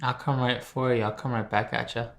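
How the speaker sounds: ordinary talk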